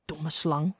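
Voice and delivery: low, soft hiss under the breath; hissed insult. A narrator's reading. Little adder as the snake leaves, hissing softly. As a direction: hiss it quietly to yourself. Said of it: "siste klein addertje".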